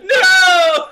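very high, loud "no"; real shriek of defeat; wailing scream. Real, not acted. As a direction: scream it out, half laughing. Losing in the game.

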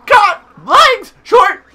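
high, loud squawk, word by word; boastful game bird. Acted. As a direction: announce it in a slow cartoon squawk. Reads game bird's dialogue.

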